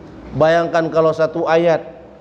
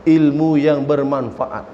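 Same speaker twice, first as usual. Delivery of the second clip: ordinary delivery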